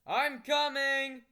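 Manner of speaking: loud, slow, high reply; calling back. Acted. Dad calls down loudly that he's coming. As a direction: answer from upstairs.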